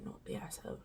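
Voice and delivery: very soft British accent; hushed, put-on British accent. Shown as a demonstration. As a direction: quote it quietly in British. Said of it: "into a British accent when I say".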